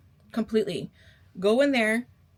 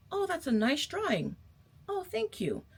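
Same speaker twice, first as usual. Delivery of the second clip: higher, softer, sugary back-and-forth; sweet polite exchange; 9 semitones higher, 4 dB quieter than usual. Retold or imitated. Telling viewers how to compliment, voicing a sweet high exchange. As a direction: act out the gracious compliment and the gracious thanks. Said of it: "If you think my drawing is nice, say".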